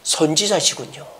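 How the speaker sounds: "선지자시군요" spoken quietly and reverently, falling gently. Acted. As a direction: say it with quiet awe. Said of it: "이 여인은 지금".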